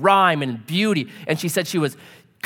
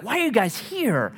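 mildly puzzled question, pitched up; retold bafflement, a higher voice; 6 semitones higher than usual. Counselor to a seemingly fine couple, puzzled.

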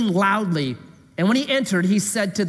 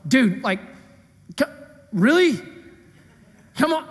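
mock exasperation; 6 semitones higher than usual. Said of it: "is going".

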